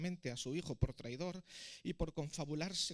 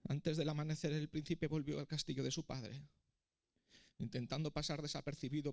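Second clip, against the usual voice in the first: ordinary delivery, nothing marked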